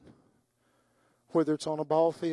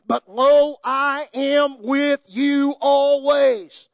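slow, much louder and higher, swelling to a cry on always; impassioned preaching; 7 semitones higher, 11 dB louder, slower than usual. Acted. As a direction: proclaim the promise with rising fervour. Quoting Jesus, building to climax.

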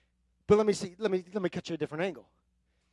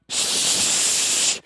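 hushing the devil. Pretend. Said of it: "look at the enemy and go".

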